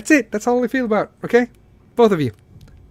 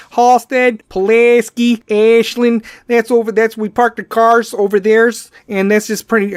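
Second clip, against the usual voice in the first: loud, higher exaggerated Chicago accent; 6 semitones higher, 6 dB louder than usual. Shown as a demonstration. Podcaster showing how the accent works, exaggerated Chicago accent. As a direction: stretch the vowels in a mock Chicago accent. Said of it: "you add a bunch of vowels".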